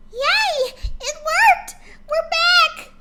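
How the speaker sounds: very high, loud, bubbly cheer; excited squeal. Acted. Pinkie cheering to friends on returning, excited squeal. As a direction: squeal it out like a thrilled party pony. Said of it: "Pinkie said excitedly".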